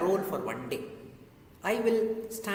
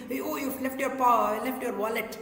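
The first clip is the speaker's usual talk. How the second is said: higher, louder call after someone; 5 semitones higher, 5 dB louder than usual. Acted. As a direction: call out urgently to remind them. Cleaner wants to alert rich man.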